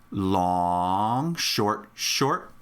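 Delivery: LONG stretched out, each SHORT brief; rhythm demo. Shown as a demonstration. Teacher showing a rhythm by colors, long held out, shorts brief. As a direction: hold "long", then two quick "short"s. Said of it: "use these colors to say a rhythm, I would say".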